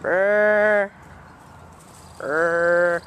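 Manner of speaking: loud trilled barking brr. Pretend. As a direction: bark out a rolled brr. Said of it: "I was barking".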